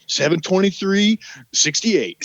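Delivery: each number said slowly and carefully; slow drilled recital. Retold or imitated. Rehearsing the fake ID birthday with a friend, slow careful recital. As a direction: spell out the date like a drill.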